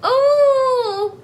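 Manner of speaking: high howling wolf cry; werewolf howl. Pretend. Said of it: "That's my werewolf howl".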